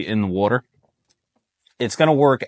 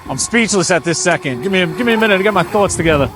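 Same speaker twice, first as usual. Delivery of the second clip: excited; 12 semitones higher than usual. Real, not acted. Driving RC boat, thrilled.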